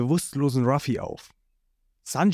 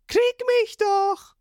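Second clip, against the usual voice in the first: put-on much higher, teasing boyish voice; cheeky Ruffy taunt. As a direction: dare him to catch you.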